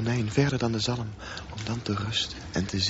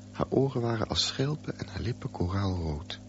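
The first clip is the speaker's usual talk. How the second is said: usual speaking voice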